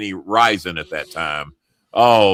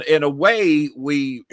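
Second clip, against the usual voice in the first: ordinary talk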